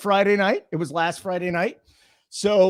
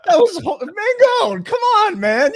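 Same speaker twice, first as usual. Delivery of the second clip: much higher and louder, calling him out; outraged yelp; 13 semitones higher, 4 dB louder, slower than usual. Real, not acted. A friend yelling at a buddy over bad trash talk, high outraged cry. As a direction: shriek his name in disbelief.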